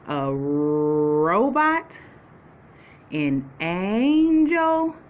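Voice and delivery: stretched-out words, slow and deliberate; slow picture naming. Shown as a demonstration. Teacher slowly naming pictures for the kids. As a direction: draw out each word for young learners. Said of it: "I see three pictures".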